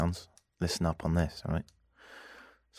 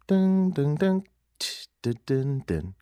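mock music sting; 7 semitones higher, slower than usual. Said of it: "I'll play some music".

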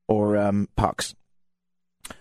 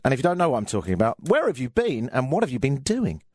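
usual speaking voice

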